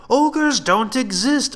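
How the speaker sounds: very high, slow, loud exclamation; exclaiming soldier. Acted. Another man exclaiming at the ogre talk, high and slow. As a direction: stretch it out high in disbelief. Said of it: "another man exclaimed".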